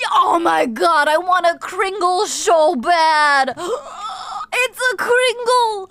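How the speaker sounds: loud, slow, high whiny half-sob; mock crying craving. Real, not acted. Streamer longing for a Kringle, hammed-up whiny cry. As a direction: wail for the pastry you crave.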